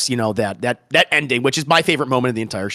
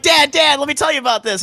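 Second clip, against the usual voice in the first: put-on much higher, louder, a girl eagerly pleading with her dad; eager daughter; 5 dB louder than usual. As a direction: rush to explain yourself to Dad.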